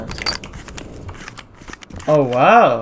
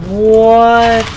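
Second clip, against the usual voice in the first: much higher and louder held what; shocked what; 7 semitones higher, 8 dB louder than usual. Real, not acted. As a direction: genuine stunned outcry. Shocked by death in game.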